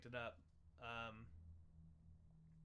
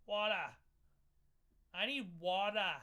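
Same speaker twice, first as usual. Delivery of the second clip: lightly voiced toddler whine, louder; 4 semitones higher, 7 dB louder than usual. Shown as a demonstration. Showing Boston speech as a toddler asking for water. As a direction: ask for water like a little kid. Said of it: "how a three-year-old would say water".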